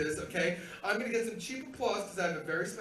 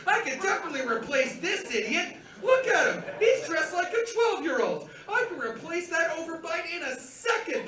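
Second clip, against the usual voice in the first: raised-pitch, jeering disbelief; scornful mockery; 5 semitones higher, 4 dB louder than usual. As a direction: jeer like a rival sizing up a fool, growing louder. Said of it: "and said".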